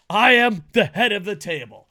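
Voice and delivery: loud, declarative promo boast; wrestler catchphrase. Acted. Host acting a wrestler's promo, emphatic boast. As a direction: proclaim it firmly to the crowd. Repeats catchphrase more measured.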